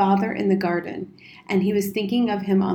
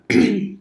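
short, deliberate throat-clear; officious ahem; 5 dB louder than usual. A narrator's reading. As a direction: clear the throat before holding forth. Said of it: "he cleared his throat".

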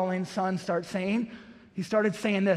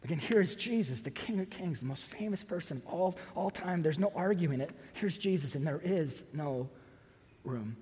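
much softer, lower, sinking to near silence; preacher dropping to a hush after the build; 5 semitones lower, 10 dB quieter than usual. Acted. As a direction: let it fall to a hush. Hushed contrast after proclamation.

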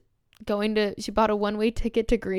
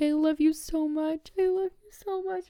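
mock baby crying; 7 semitones higher than usual. Fan hugging her idol on stage, imitated baby crying. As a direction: imitate a tearful wail, climbing higher. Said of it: "I just start baby crying".

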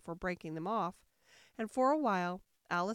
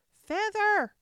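high, loud one-word command; sudden high shout; Sheep's cry; 11 semitones higher, 10 dB louder than usual. A narrator's reading. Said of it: "cried the sheep".

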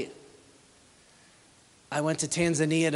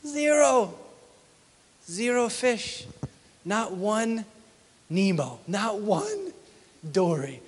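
higher, punched repeated zero; emphatic count; 5 semitones higher, slower than usual. Real, not acted. Preacher stressing the empty catch, high and emphatic. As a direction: hammer home there was nothing.

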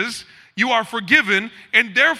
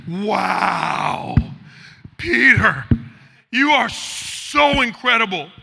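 mock-impressed praise; much slower than usual. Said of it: "expecting is a slow clap from Jesus".

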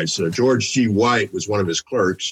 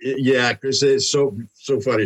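normal talking voice

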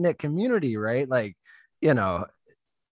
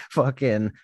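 ordinary delivery

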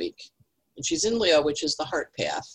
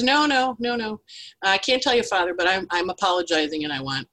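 put-on little boy; 5 semitones higher than usual. Altar boy begging forgiveness in a high voice. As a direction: plead like a flustered kid. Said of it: "And the little boy's".

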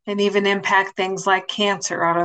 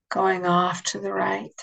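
guided meditation. Acted. Leads a guided imagery meditation.

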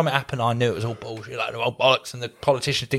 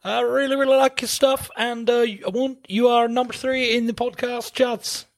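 high, lilting put-on Norwegian accent; imitated foreign accent; 8 semitones higher than usual. Host relaying good chart news in the caller's high, vague Norwegian accent. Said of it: "I don't know where his accent was".

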